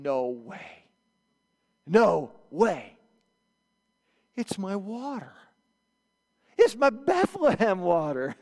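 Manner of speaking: delighted king. Retold or imitated. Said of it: "picture David going".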